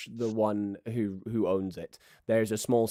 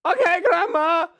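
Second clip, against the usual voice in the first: high, loud obedient grandson; raised-pitch shouted reply; Swancho; 7 dB louder than usual. Acted. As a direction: call back dutifully to grandma. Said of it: "Swancho looks around and goes".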